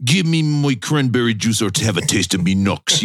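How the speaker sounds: hammed-up British punk; mock British accent. Podcast host demanding cranberry juice, mock British accent. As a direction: put on a rough-and-ready British accent. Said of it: "doing a perfect British accent".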